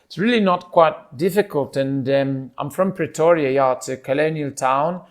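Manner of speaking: easy, chatty South African voice; South African accent. Shown as a demonstration. Pretoria local urging someone to visit, South African accent. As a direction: talk up your hometown as a South African. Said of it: "I'm just coming back to South African".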